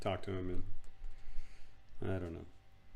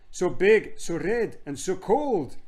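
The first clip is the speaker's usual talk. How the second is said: put-on high, loud child voice, word by word; pitched-up boy listing with emphasis; awed boy; 8 semitones higher, 6 dB louder, slower than usual. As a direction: pipe up as a wondering boy.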